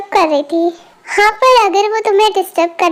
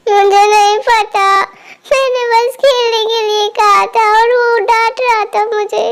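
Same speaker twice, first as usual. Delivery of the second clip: acted tearful whine; crying child. Little girl explains the scolding to aunt, crying. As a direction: whimper out the complaint. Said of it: "तुम रो क्यों रही हो".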